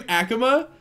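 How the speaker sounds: Akima as AK-uh-muh, high and rising in a question; English-style pronunciation. Shown as a demonstration. Streamer checking a viewer's name, Akima as questioning AK-uh-muh. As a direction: ask Akima with first-syllable stress, AK-uh-muh. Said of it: "Cause I've been saying Akima".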